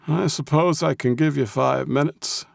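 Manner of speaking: put-on high-pitched grudging old codger; crabby old man. A narrator's reading. Old man relenting to the Russian's request, crabby. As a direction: give in grudgingly, old and cranky. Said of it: "his best crabby old man voice".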